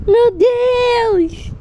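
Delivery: high panicked screams; terrified screaming. Real, not acted. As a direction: scream in real terror. Screaming in fright.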